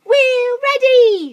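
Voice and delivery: very high, loud, slow chorus; high monkey chorus. Acted. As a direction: squeal it as eager monkeys. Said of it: "said the monkeys".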